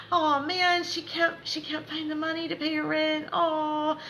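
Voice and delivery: high-pitched pretend boo-hoo; mock crying. Spirit guides pretend to grieve over her rent, mock crying. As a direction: ham up a tearful lament. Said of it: "and they're crying".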